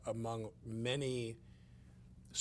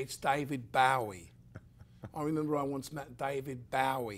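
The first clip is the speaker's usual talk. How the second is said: slowed, louder English accent imitation; 5 semitones higher, 9 dB louder than usual. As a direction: lightly mimic a Brit saying the name. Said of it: "He'd say".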